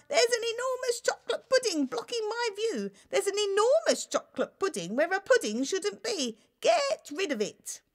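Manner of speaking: high, loud, frantic outcry; frantic cry. Acted. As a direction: shout in panic at the sight. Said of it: "he cried".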